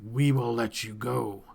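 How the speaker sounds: slow, gloating creature voice; lightly voiced creature leader, gloating; masked creature leader. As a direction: savour the offer of a deadly game. Masked leader addresses captives.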